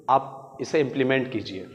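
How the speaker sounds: ordinary delivery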